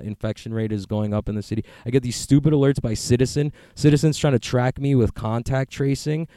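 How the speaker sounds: ordinary delivery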